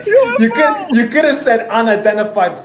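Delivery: real laughing fit; laughing hard. Real, not acted. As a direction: burst out laughing as you speak. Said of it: "laughing fit at answer".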